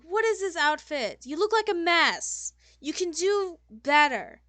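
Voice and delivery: high, loud diva scolding; theatrically scandalised fashion critic; appalled fashion designer. Acted. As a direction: tear into them like an offended designer.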